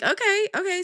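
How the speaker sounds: high-pitched, hurried "okay, okay"; placating okay. Retold or imitated. Customer retelling how she answered the scolding nail tech, high and placating.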